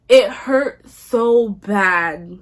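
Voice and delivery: "so bad" loud and dragged out; very slow, heavy weight on "so bad"; anguished emphasis. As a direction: stretch "so bad" to stress the hurt. Dramatic retelling of breakup pain.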